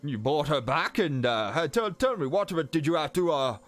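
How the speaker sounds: flustered uncle with swooping pitch; Walden Crane. Acted. Uncle quizzing the adventurers about slimes, flustered and eager. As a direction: stammer out praise and eager questions.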